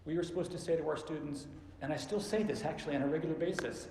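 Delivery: usual speaking voice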